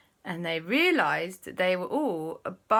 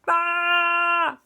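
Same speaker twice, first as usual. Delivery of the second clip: long, high, loud "aah"; stretched-face cry; 11 semitones higher, 6 dB louder than usual. Acted. Storyteller shows aunt's face at speed, drawn-out cry. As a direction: sound out a face blown back.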